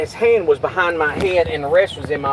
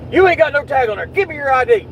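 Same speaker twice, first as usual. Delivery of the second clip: much higher, louder mock cop voice; 10 semitones higher, 4 dB louder than usual. Imitating the cop demanding his ID: much higher mocking voice. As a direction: mimic the cop's demand scornfully.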